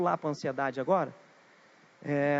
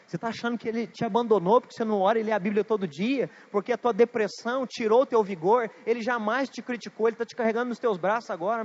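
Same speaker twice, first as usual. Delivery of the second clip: impassioned preaching; 4 semitones higher, 6 dB louder than usual. Retold or imitated. Pastor pleading with the depressed elder.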